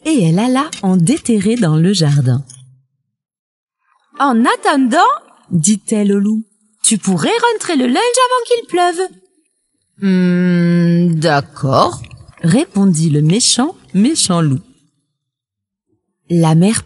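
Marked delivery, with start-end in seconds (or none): 4.2-5.1 voix de poule haut perchée; mère poule autoritaire. A narrator's reading. La poule commande au loup. 6.8-9.0 voix de poule haut perchée; mère poule autoritaire. A narrator's reading. La poule commande au loup. 10.0-12.0 « hmm » traînant puis d'accord plus bas; loup réticent. A narrator's reading. Le loup accepte à contrecœur.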